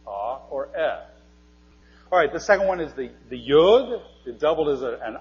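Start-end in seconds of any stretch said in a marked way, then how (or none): 0.1-1.0 "Ah" as an open vowel, "eh" as a short E; vowel sound demo. Shown as a demonstration. Teacher showing the sounds of aleph, vowel sound demo. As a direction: sound out "Ah" and "eh" as separate pure vowels. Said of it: "represented the ah or the eh".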